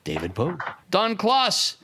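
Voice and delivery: drawn-out names, called out like a host; announcer roll call. Acted. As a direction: announce each name with flourish. Reads sponsor names ceremonially.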